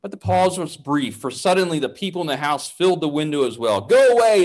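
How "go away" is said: loud, high command; loud demand. A narrator's reading. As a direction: order the intruder off hard. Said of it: "they demanded".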